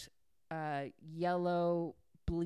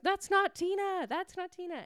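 urgent protest; 13 semitones higher, 4 dB louder than usual. Retold or imitated. Justice finally telling Knox, high-pitched urgent protest. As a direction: break in with an exasperated high-pitched correction. Bystander finally breaks in to correct.

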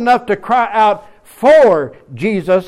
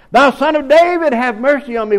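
crying out; 7 semitones higher than usual. Retold or imitated. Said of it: "he begins to cry out".

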